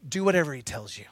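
Mary quietly trusting her son, soft and low; tender. Retold or imitated. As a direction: say it softly, like a knowing mother. Mary smiling, tender line.

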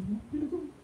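high, coy voice passing the task along; lightly voiced reluctant believer; mock reluctant brother. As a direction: squeak out the excuse like a shy church member. Brother voiced in retold exchange.

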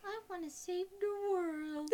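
high, slow drugged diva cry; fading Miss Piggy. Pretend. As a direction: fade out like a sedated diva. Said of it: "as she's, like, fading out?".

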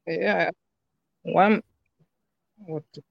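audio breaking up, dropping out; choppy, glitching call line; glitchy robotic call. Real, not acted. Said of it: "Your audio is breaking up a little bit".

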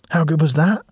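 high, loud and thrilled; buzzing after the ride; excited boy. Acted.

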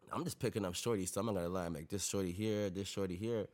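ordinary delivery

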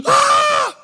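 shuddering gasp into a high yelp; hammed-up scream of fright, very high; mock terrified gasp. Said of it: "Why isn't he going".

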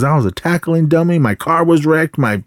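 high, aggrieved imitated rant; mock angry yell. Fed-up teacher lists his sacrifices, mock yelling. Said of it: "he was just yelling at all of them".

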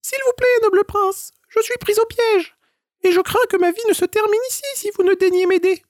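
very high, squeaky little squirrel voice pleading. A narrator's reading. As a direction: beg like a tiny trapped squirrel. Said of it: "L'écureuil implora le prince". (petite voix aiguë d'écureuil suppliant)

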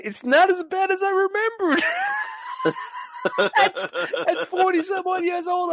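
very high, squealed through laughter; laughing disbelief. Retold or imitated. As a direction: crack up in a high squeal as you talk. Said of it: "I'm laughing. I'm, as I'm eating it".

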